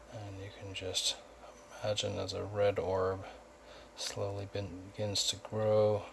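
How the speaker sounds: soft, calm meditation guide voice; hushed, even guidance with unhurried gaps; guided meditation. Acted. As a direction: softly lead them inward, leaving long pauses. Leads guided chakra meditation.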